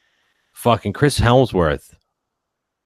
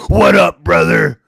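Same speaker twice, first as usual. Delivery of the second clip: big, high, booming greeting shout; hyped wrestler shout; 8 semitones higher, 9 dB louder, slower than usual. Pretend. Shouted catchphrase greeting between wrestling fans.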